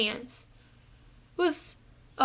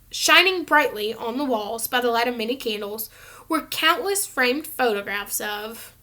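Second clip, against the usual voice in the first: ordinary delivery, nothing marked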